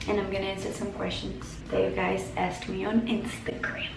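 slow soft whisper. Real, not acted. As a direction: breathe the words, unvoiced. Sussurra o anúncio das perguntas. (sussurrando devagar)

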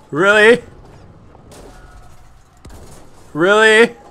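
high, loud incredulous cry; outraged disbelief. Real, not acted. Gamer protesting a cheap death, loud disbelief. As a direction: yell it like you can't believe it.

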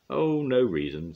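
high, loud and knowing. A narrator's reading. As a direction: play innocent with a wink. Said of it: "winking at Little Miss Magic".

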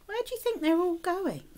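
usual speaking voice

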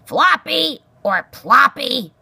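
loud, high, slow bellow. A narrator's reading. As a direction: bellow the botched names. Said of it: "Aerith bellowed".

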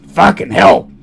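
ordinary delivery

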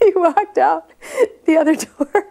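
high, loud words broken by laughter; laughing while talking. Real, not acted. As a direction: finish the story through real laughter. Teller breaks up ending her story.

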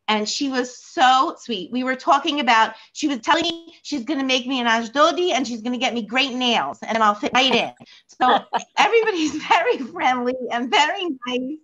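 ordinary talk, nothing marked

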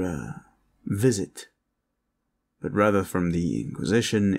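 ordinary talk

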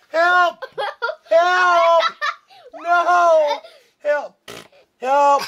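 high laughing yells; mock shouting for help; playful cries for help. Grandpa cries help while tickled.

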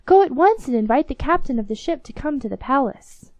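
excited king. Acted. King marvels at the ship and orders the captain fetched, mild excited call. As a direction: keep the king's amazed call going. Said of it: "he cried".

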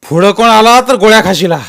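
loud, high threatening roar; roaring warning. A narrator's reading. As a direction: stop the crowd dead with it. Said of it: "ज्ञानू गरजला". (गरजून इशारा)

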